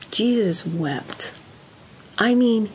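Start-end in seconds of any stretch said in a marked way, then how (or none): 0.1-1.3 slow solemn. Real, not acted. Solemn scripture reference.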